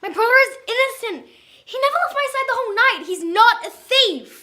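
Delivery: loud, impassioned defence; heated protest. Acted. Defending an accused brother before the vote, heated protest. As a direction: insist on it at full voice.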